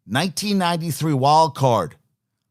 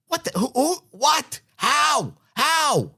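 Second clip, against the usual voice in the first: sputtering, much higher, can't find the words; stunned disbelief; 11 semitones higher, slower than usual. Real, not acted. Radio host floored by the coach's excuse, sputtering disbelief. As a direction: gasp out each question in disbelief.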